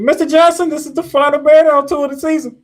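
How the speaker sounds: show host intro. Real, not acted. Host introduces final segment.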